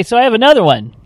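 ordinary talk, nothing marked

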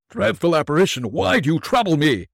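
high, pleading and afraid; frightened pleading. Acted. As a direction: plead with the apparition. Scrooge terrified, on his knees.

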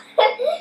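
short high chuckle; amused laugh. Real, not acted. Reader jokes about frog's bottom jaw, high chuckle. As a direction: let out a real little laugh.

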